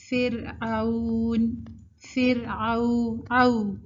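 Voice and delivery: فرعون drawn out as fir-auun; correct leen. Shown as a demonstration. Teacher modelling another leen word, slow glide. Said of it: "جب لین کی صفت add کر رہے ہیں".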